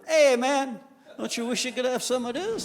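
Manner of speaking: high, taunting bug voice, laughing; teasing beetle. Acted. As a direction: squeak out a smug tease at the anteater. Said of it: "walk by the anteater teasing him".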